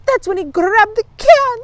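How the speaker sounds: put-on high, loud girlish outrage; indignant outcry. A narrator's reading. Fanchon reports the theft, loudly indignant. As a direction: cry out the wrong done to you.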